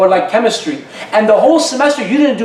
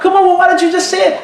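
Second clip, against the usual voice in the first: much higher, mock disbelieving outburst; exasperated husband; 10 semitones higher than usual. Husband protesting to wife over dinner choice, high mock outburst.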